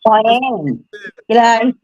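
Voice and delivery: Australian accent, a cheerful g'day. Real, not acted. Aussie guest greets the hosts in Australian accent. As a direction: greet them in broad Australian. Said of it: "Love the accent".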